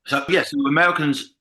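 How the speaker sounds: ordinary talk, nothing marked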